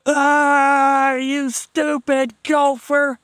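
high, slow, strained mock yell at the gopher; a friend's high pitched drawn-out cry from behind. Friend chasing a gopher shouts at it from behind, high and slow. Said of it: "we hear somebody going".

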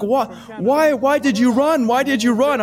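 broken English policemen. Retold or imitated. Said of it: "And they're like".

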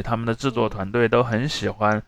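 ordinary delivery

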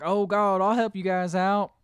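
a hint of backwoods father offering help. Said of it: "middle of nowhere hick kinda guy".

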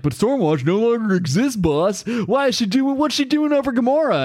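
lackey squeaking up at his boss; dim henchman. Retold or imitated. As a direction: play the nervous idiot henchman, voice climbing. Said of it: "this one idiot is like".

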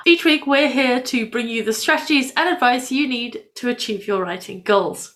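ordinary talk

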